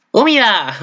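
high excited cry of "¡Húmida!"; meme shout. Retold or imitated. Fan quoting the Umi meme, excited high cry. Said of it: "sale el meme de Umi".

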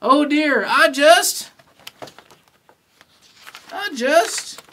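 high, loud, halting struggle; acted panic, climbing to a high wail; panicked straining. A narrator's reading. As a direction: stammer out as the grip gives, stretching the last word. Kevin losing his grip while clinging.

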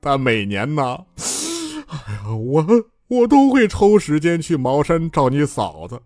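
slow, heartbroken complaining; tearful lament. A narrator's reading. Bai Jianfei telling his junior brother his woes, slow and tearful. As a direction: pour out your sorrow, rubbing your eyes. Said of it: "白剑飞揉着眼睛说得老伤心了".

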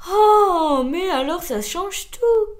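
loud, high drawn-out oh; astonished exclamation. Acted. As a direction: burst out loudly at the news. Snail astonished at the news.

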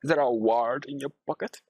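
ordinary talk

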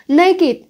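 NAKED with a separate -id ending; two-syllable naked. Shown as a demonstration. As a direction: say "Naked" as NAY-kid, high first syllable. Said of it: "phải đọc là naked".

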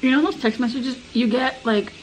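chewing while talking; speaking around food; mouth full. Real, not acted. Seen 4: Bites cucumber at -2; mouth looks full and chewing through about 1.9s.

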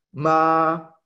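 MA held high and level; first tone, a long high level MA; high sustained tone. Shown as a demonstration. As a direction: sing MA on one high, even note. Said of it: "sonido alto sostenido".

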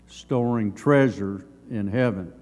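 normal talking voice

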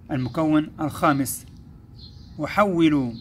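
ordinary talk, nothing marked

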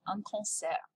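concert said the French way; French accent, nasal vowel, silent final t. Shown as a demonstration. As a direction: demonstrate a French pronunciation. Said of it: "just pronounce it with a French accent".

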